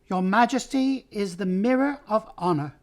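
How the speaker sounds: high-pitched courtly mouse; Reepicheep. A narrator's reading. Mouse flattering the king with a bow, shrill voice. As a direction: pay homage in a high, shrill voice. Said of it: "came a shrill voice".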